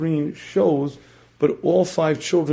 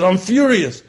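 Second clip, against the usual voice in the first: higher, louder mock outburst; acted fury; 4 semitones higher, 6 dB louder than usual. The angry inner self answering the questioning one, furious. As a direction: insist hotly, swelling on FURIOUS. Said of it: "why am I furious?".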